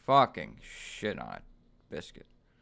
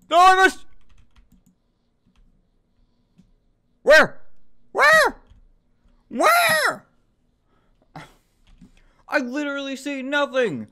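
high loud panicked shouts; shrill desperate yells; frantic yelling; 11 dB louder than usual. Real, not acted. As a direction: yell in frustrated panic. Frantic shooting, can't see.

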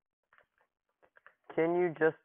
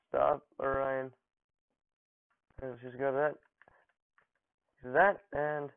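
ordinary talk

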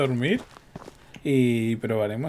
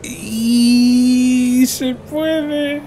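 very high, loud and stretched out; drawn-out excited cry; 11 semitones higher, 9 dB louder, much slower than usual. Real, not acted. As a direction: stretch it out in triumph. Countdown then car fits in.

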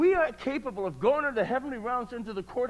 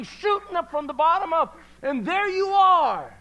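preaching; 7 semitones higher, 6 dB louder than usual. Acted. Preacher telling church they have resurrection power. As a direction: drive it home, slowing at the peak.

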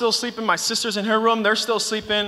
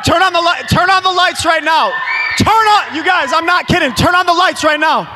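high, loud alarmed demand; panicked shouting; 6 semitones higher, 4 dB louder than usual. Acted. Speaker to crew during staged scare, panicked shout for lights. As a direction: yell it urgently, as if truly frightened.

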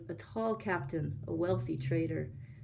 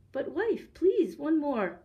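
higher-pitched, coaxing plea; pleading; 8 semitones higher, 4 dB louder, slower than usual. A narrator's reading. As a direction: beg with a drawn-out please. Husband begs wife for another.

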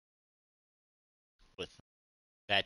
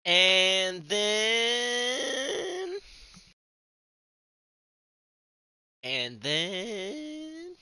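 high, very slow drive-thru voice; much higher, drawn-out drive-thru squeak; drive-thru speaker voice; 7 dB louder, much slower than usual. Retold or imitated. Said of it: "you've never seen Dude, Where's My Car?".

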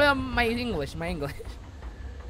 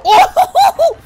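surprised laughing shriek; 15 dB louder than usual. Real, not acted.

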